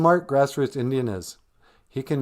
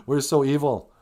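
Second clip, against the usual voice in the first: louder, higher hammed-up villain laughter; mock evil laughing; 8 semitones higher, 9 dB louder than usual. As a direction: cackle into the line like cartoon villains.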